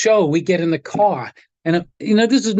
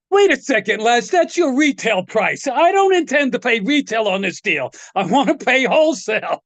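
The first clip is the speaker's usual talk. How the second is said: higher-pitched, emphatic protest; retold mock objection, pitched up; mock indignant haggling; 5 semitones higher than usual. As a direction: act out a cheeky customer driving a bargain. Retold haggling with [name].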